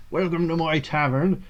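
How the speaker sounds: lightly voiced old innkeeper; wise old man voice. Shown as a demonstration. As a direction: greet them like a kindly old tavern keeper. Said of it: "or it's sort of like".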